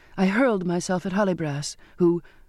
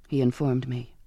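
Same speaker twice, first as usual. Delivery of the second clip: usual speaking voice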